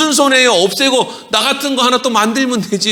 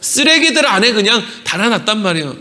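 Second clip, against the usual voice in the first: ordinary talk, nothing marked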